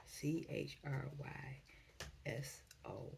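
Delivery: very soft, near-whispered letters, slow; spelling under breath. Real, not acted. Reader looking up the word in a dictionary, spelling under breath. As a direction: spell it out quietly to yourself, letter by letter.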